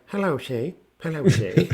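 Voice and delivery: weak, unconvincing put-on accent; half-hearted attempt. Mocking the brother's timid phone greeting, half-hearted. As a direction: imitate an actor who won't commit. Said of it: "but he wouldn't commit".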